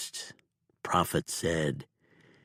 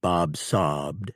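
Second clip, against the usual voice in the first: usual speaking voice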